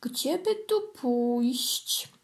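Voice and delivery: very slow musing question; pondering. Acted. Kitten wonders aloud where to go, very slowly. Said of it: "zastanawiał się".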